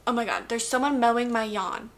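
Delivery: ordinary delivery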